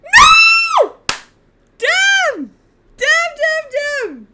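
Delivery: high, loud yelled frustration; furious shout. Real, not acted. Streamer yells at a lost chess game, furious shout. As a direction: scream it out at the board.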